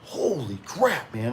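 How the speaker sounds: retold dismay at a flooded basement; stunned. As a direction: let the shock stretch the words out. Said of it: "I'm like".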